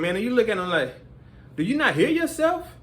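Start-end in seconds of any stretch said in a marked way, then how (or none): 1.6-2.7 high, incredulous; pitched-up disbelief; exasperated. Retold or imitated. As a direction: ask it in disbelief at the chewer. Exasperated at a loud eater.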